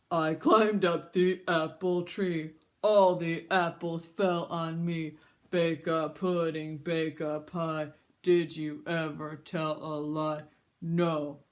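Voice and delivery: put-on slow, low old grandpa chant. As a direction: drag it out like an old man. Grandpa chanting his rhyme.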